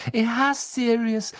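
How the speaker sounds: high, pleading protest; desperate. A narrator's reading. Girl protesting a professor's hatred to the headmaster, desperate. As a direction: argue desperately, voice pitched right up. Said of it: "sagte Hermine verzweifelt".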